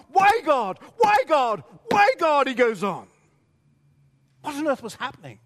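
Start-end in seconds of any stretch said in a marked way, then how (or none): 0.1-2.5 loud, high repeated cry, each why falling; howl of pain. Acted. Psalmist crying out to God, loud anguished howl. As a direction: beg God with rising anguish. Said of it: "drawn-out howl of pain".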